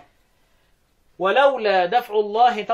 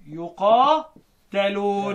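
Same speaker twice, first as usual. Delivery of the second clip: drawn-out Quranic recitation of one word; slow recitation; much slower than usual. Shown as a demonstration. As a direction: stretch the word as in tilawa. (ترتيل بطيء للكلمة)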